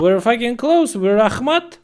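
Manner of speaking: high, loud call-back; mock soldier shout. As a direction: shout it like soldiers answering across the street.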